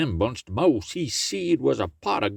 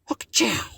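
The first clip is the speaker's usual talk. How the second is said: a big high comic sneeze; 13 semitones higher than usual. Acted. Brer Rabbit sneezing in the chimney, comic sneeze. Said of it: "he sneezed".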